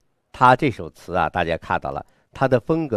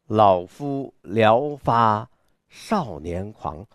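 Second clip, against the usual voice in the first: very slow declamation, syllables stretched out; 少年狂的朗诵; much slower than usual. Shown as a demonstration. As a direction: recite grandly with youthful abandon, drawing out each word. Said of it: "发一下少年的这样的一个状态". (豪放地拉长朗诵)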